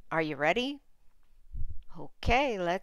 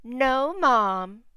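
cheeky Tim; 4 semitones higher, 7 dB louder than usual. Acted. Tim turns it on Mom.

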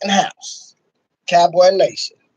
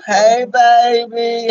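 drawn-out greeting; 3 semitones higher, 4 dB louder, much slower than usual. Real, not acted. Greeting his boo on live.

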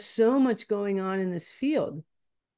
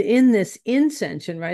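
ordinary delivery

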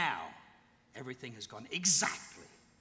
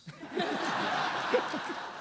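villain laugh; 14 semitones higher, 5 dB louder than usual. Acted. Villain gloats at his deeds.

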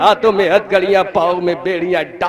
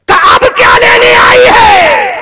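high, loud, anguished wail; a daughter wailing at her mother in grief; anguished cry; 11 dB louder than usual. Acted. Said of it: "حضرت زینب نے تڑپ کے کہا".

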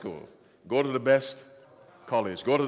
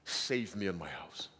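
soft plea; 6 semitones lower, 9 dB quieter, slower than usual. Retold or imitated. Rahab pleading with the spies.

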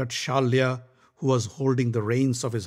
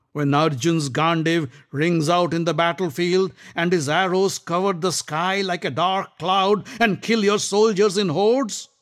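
higher, louder taunting; mocking Shalya; 7 semitones higher, 4 dB louder than usual. Shalya taunting Karna about Arjun, mocking. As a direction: needle him.

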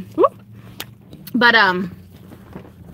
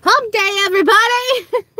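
cheery shout-out; 10 semitones higher than usual. Acted. Greeting viewers on hump day.

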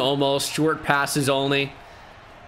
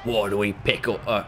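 Alfred; 3 semitones lower than usual. Retold or imitated.

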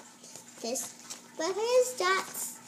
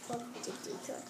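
whisper; 5 semitones lower, 15 dB quieter than usual. Real, not acted.

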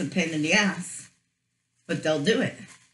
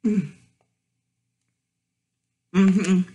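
chewing while humming; eating a burger; mouth full; 6 dB louder than usual. Real, not acted. Seen 5: Bites burger at mouth, then cheeks full, chewing through stretch.

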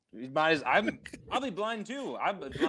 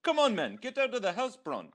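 put-on South African accent; 6 semitones higher than usual.

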